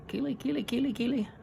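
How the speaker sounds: put-on very high, thin child's call; little girl's cry. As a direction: squeak it out like a scared little girl. Girl's frightened cry in story.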